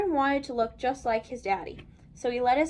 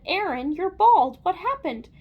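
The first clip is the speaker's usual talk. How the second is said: higher, louder voice of alarm; shocked mother; 5 semitones higher, 7 dB louder than usual. A narrator's reading. Mother sees Aaron is bald, shocked exclamation. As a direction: exclaim it like a startled mom.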